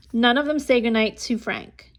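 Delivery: ordinary delivery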